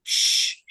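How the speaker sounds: unvoiced shush for silence. Real, not acted. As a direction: hush the class sharply. Teacher hushing a noisy student.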